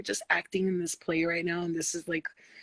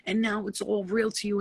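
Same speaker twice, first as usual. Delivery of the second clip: normal talking voice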